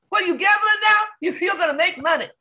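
very high, shouted nagging; put-on scolding voice. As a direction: crank it way up high and nag. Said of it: "But if I do my regular voice".

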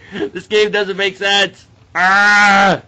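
high, drawn-out yell building to a scream; frustrated shouting. Real, not acted. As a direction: complain loudly, then burst into a scream. Frustrated at failing the game.

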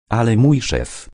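mildly sorry, starting to object; apologetic. A narrator's reading. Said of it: "zacząłem przepraszająco".

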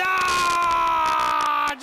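loud, high cry of disbelief; shocked shout. Real, not acted. Commentator shouting at a brutal turnbuckle drop. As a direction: yell it like you can't believe it. Commentator reacts to a big move.

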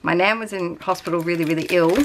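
normal talking voice

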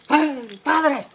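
loud, gruffly insistent mock anger; angry. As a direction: call out to the priest as an angry man. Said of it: "bien enojado".

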